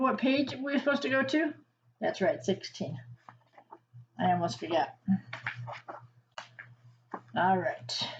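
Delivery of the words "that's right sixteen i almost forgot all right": ordinary delivery, nothing marked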